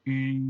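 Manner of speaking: I said with lips rounded as for U; French u sound. Shown as a demonstration. Teacher demonstrates the French u vowel. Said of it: "pero diciendo I".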